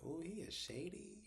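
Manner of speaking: high, soft, drawn-out aside; suspicious aside. Retold or imitated. Maurice remarks on the stranger handing out drinks, suspicious aside. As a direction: draw it out softly, relishing the suspicion.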